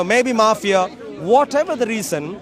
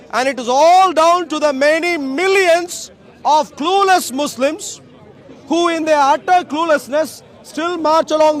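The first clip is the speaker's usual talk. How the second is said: raised, higher preaching voice to the crowd; street preaching; 4 semitones higher than usual. Acted. Street preacher addressing the Speaker's Corner crowd, full-voiced preaching. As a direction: proclaim it like a soapbox preacher.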